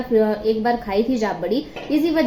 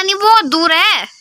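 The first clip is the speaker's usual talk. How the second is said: raised, higher call across the roof; 8 semitones higher, 7 dB louder than usual. Real, not acted. Vlogger calling to mum that it's too far, loud call. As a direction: call out so mum can hear.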